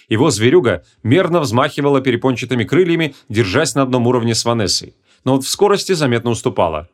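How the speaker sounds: ordinary delivery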